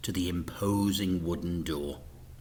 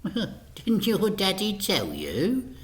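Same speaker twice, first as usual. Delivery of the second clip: higher, slow smirking taunt; 9 semitones higher, slower than usual. A narrator's reading. Mister Big taunting Frank, smirking. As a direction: tease the boy cruelly. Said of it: "with a smirk".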